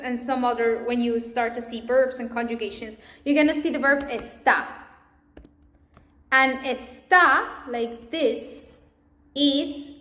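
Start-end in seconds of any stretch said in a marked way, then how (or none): 4.1-4.6 está with the accent on "tá"; stress on last syllable. Shown as a demonstration. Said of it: "Because has this accent". 6.6-7.7 long, loud "tá" in está; stress on last syllable. Shown as a demonstration. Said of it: "Because has this accent".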